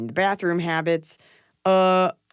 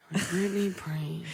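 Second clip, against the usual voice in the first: Yertle the Turtle voice; 9 dB quieter, slower than usual. Pretend. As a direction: drag it out like a sleepy turtle. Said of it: "Your Yertle the Turtle voice".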